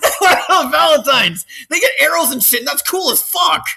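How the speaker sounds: high, loud, tearful shouting; hammed-up wailing; mock tantrum. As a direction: bawl out the envy like a child. Continuing the whining angel's tantrum.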